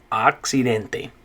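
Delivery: accidente slowly, ahk-see-DEN-teh; teacher models accidente; slow Spanish model word. Shown as a demonstration. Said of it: "Good job".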